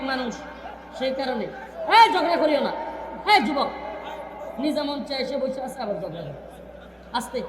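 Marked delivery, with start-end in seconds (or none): 4.6-6.3 softer, chuckling through the words; laughing aside. Real, not acted. Preacher chuckles at a young man quarrelling on stage. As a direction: laugh it off quietly.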